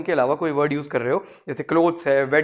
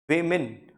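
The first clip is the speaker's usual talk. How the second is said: Women as WIM-in, weight on WIM; falling women with a light second syllable; short "i", stressed first syllable in women; slower than usual. Shown as a demonstration. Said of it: "the stress is on the first".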